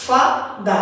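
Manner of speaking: "ফা", pause, "দা", two separate beats; syllable by syllable. Shown as a demonstration. Teacher showing the two syllables, "ফা" then "দা" apart. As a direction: break it cleanly into "ফা" and "দা". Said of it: "এক গতিতে একবার এটা উচ্চারণ করছি".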